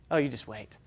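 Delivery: sudden drop, low and soft; knowing warning. Acted. John warns of the one coming, low and knowing. John's answer to raised conditions.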